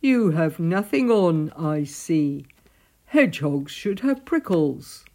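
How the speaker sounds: loud, disapproving animal voice; forceful storybook reprimand; snorting Badger. A narrator's reading. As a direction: boom it out like a scolding badger. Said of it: "Badger snorted".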